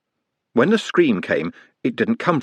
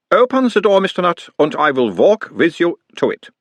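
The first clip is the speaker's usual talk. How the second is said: put-on higher, louder doctor's voice with a light Uberwald accent; mild Uberwald accent; 8 semitones higher, 3 dB louder than usual. A narrator's reading. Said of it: "mild Uberwaldian accent".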